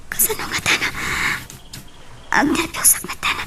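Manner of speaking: hushed, secretive whisper on the phone; breathy secret whisper. Acted. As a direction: whisper so the parents won't hear. Secret phone call to boyfriend.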